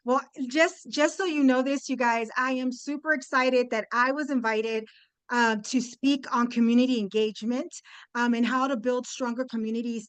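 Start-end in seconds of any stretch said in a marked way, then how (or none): none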